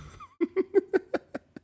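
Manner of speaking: squealing high mimicked laugh; high giggle. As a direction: let out a squeaky delighted giggle. Imitating friend's laugh.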